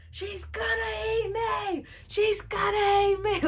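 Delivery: high, drawn-out panicked cry; mock terrified wail. Child fleeing grandma through the house, hammed-up terror. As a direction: wail it like you're about to be devoured. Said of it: "I'm so scared for my life".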